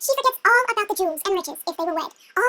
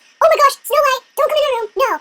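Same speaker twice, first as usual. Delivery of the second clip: loud, higher mock panic; hammed-up embarrassed alarm; panicked beast; 6 semitones higher, 5 dB louder than usual. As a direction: blurt out a flustered warning. Beast panicking about his room.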